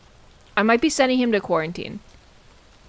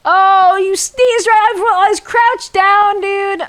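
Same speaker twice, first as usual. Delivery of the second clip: outraged yell; 10 semitones higher, 10 dB louder than usual. Real, not acted. Sneezed on while crouched.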